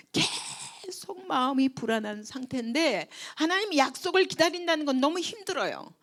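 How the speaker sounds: ordinary talk, nothing marked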